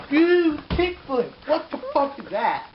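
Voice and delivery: high, loud mocking outrage; sneering at a skateboarder's trick; outraged. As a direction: scoff at the kickflip in disbelief.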